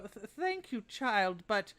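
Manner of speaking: put-on high, grateful but grim old lady; frail old woman. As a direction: pitch up, a wounded old woman. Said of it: "but she'll go".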